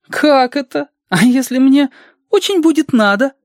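put-on very high, indignant child voice; dismayed child. Reshka objecting to a day in bed, very high child voice.

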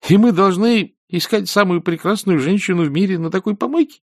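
put-on high, indignant boy's grumble; disgruntled youngster. A narrator's reading. Boy objects to searching in such a dump, disgruntled. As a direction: protest in a high, petulant voice. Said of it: "буркнул он".

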